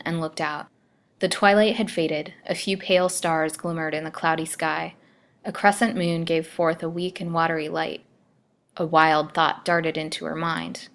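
usual speaking voice

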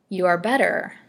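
put-on a lightly cracked old woman's voice. A narrator's reading. Old Haiti to her recovering patient, lightly cracked voice. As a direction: crack the words a little, like an old crone. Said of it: "said she in her cracked voice".

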